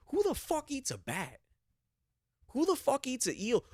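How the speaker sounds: high, strained disbelief; incredulous shriek. Real, not acted. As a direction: push it way up in outrage. Incredulous rant about eel.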